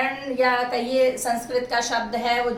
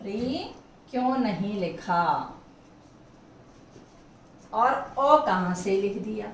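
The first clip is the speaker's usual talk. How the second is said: puzzled student; 3 semitones lower than usual. Retold or imitated. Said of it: "अब आप सोच रहे होंगे".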